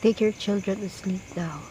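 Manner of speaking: ordinary delivery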